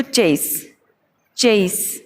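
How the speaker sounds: चेस said crisply with a clear ch and short e; teacher showing how to say चेस; careful pronunciation. Shown as a demonstration. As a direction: model चेस slowly, each sound clear. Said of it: "चेस का उच्चारण आप देख लीजिए".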